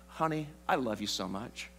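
hammed-up tender devotion; mock sweet husband. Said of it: "since I'm a godly man".

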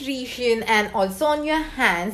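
face mask on, stiff cheeks; speaking with face covered; through a sheet mask. Real, not acted. Seen 0: Wet sheet mask covers her face; mouth opening stays free.

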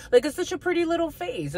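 put-on high, cooing baby talk; mock-tender, high-pitched cooing. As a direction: coo over the little face like over a pouty child. Cooing over a card's face.

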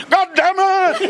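high drawn-out yell; hammed-up comic cry; mock outburst. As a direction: stretch the curse out, high and slow. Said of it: "he's going".

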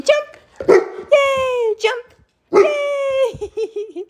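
high, squealing cheer; excited cheering. Real, not acted. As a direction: cheer the dogs on. Cheering dogs to jump.

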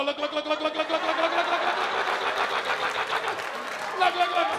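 high, rapid repeated look dissolving into laughter; mock giddy taunting; gleeful mocking. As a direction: point and laugh at your buddy. Pointing out friend's rejection.

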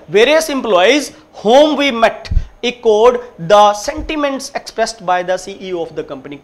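very slow, word by word; slow, deliberate reading; slow read-aloud. Shown as a demonstration. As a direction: read the finished sentence for the class. Teacher reads full answer.

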